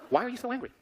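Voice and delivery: high-pitched puzzled question. Acted. Someone asks the angry person why, puzzled questioning.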